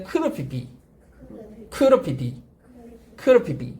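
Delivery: "크르 피비" with could've squashed to krr; reduced could have. Shown as a demonstration. Teacher drilling students on reduced could have. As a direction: repeat "크르 피비" fast, no h or v. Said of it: "할 수 있었을 텐데".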